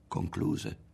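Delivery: ordinary delivery, nothing marked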